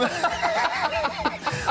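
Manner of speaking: short high-pitched giggle. Real, not acted. Laughing while presenting.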